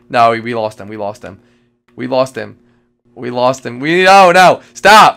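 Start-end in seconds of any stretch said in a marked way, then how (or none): 3.8-5.2 loud protest. Real, not acted. Friend blasts music in ear.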